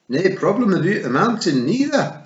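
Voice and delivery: helpful and keen; Scottish Feegle. A narrator's reading. Daft Willie offering to flatten the mountain, eager Scots.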